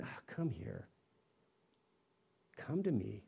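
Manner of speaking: soft, let-down sigh; a sighing, let-down come here; disappointed. Retold or imitated. Jesus imagined as disappointed, with a soft sigh. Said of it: "As though he's disappointed in you".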